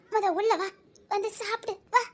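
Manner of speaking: normal talking voice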